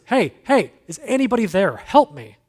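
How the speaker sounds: loud, high desperate call upward; yelling for help. Retold or imitated. Man hanging from a branch yells up for help, loud desperate shout. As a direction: shout up the cliff for rescue. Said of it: "And so he yells up".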